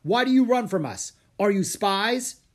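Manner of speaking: loud, high accusing questions; barked interrogation. A narrator's reading. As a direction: demand answers like a suspicious guard. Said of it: "one of the samurai barked".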